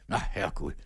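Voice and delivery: low, soft groaning dismay; mock lament.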